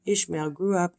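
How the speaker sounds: plain reading voice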